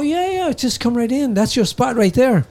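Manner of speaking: high, welcoming host voice; the Big T. Retold or imitated. The Big T inviting the narrator to his spot, high welcoming voice. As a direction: usher him in brightly.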